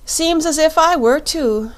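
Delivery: put-on a young girl realising she is lost too; little girl Dorothy. Dorothy sits down by the Shaggy Man, high girlish and rueful.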